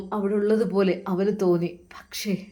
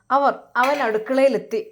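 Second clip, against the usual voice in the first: usual speaking voice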